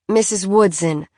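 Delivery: ordinary delivery, nothing marked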